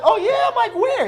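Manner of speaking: high, loud eager questions; excited surprise. Retold or imitated. Learning the owner lived in Saint Louis, excited surprise. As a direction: jump on it eagerly.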